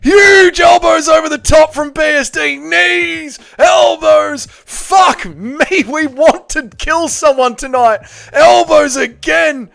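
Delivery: high, loud ringside commentary; fight commentator impression. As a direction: scream it like a frantic fight caller. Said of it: "I do a few good impressions".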